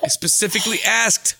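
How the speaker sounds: tense, high, measured complaint; John Malkovich. Acted. Star complaining about sugar for his tea, tensely polite. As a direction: enunciate each phrase with prissy, strained precision. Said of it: "tense John Malkovich".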